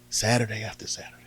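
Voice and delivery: usual speaking voice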